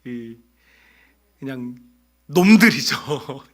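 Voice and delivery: slow, exasperated sigh then grumble; a fed-up leader sighing at his people; exasperated Moses. Acted. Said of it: "모세가 잔뜩 화가 났어요". (한숨 섞인 짜증)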